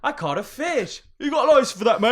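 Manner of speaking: high hammed-up British, laughter in it; mock British accent. Co-host retelling favourite British joke, high mock accent. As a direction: rise into a loud British caricature. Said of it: "my favourite British joke ever".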